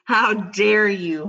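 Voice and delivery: slow, hammed-up indignation; mock offended. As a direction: act scandalised, stretching it out. Mock offence after the insult.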